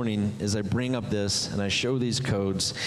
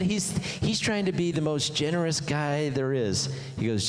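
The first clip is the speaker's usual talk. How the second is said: usual speaking voice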